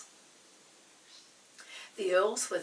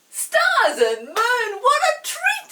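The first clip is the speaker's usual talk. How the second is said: high, loud, drawn-out cry of delight; thrilled cry; 16 dB louder, slower than usual. Acted. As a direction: burst out with sheer delight. Chinky thrilled by invitation.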